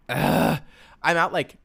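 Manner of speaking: normal talking voice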